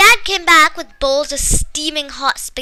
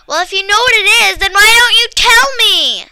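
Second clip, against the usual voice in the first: higher, louder irritated snap; annoyed girl snapping at her friend; snapped; 9 semitones higher, 9 dB louder than usual. A narrator's reading. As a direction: bite back impatiently. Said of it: "I snapped".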